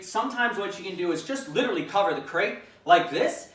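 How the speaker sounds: ordinary talk, nothing marked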